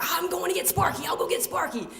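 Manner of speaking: put-on high-pitched excited kid; eager dibs. Brother claiming the pet after arrival, eager. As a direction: call it out before anyone else can.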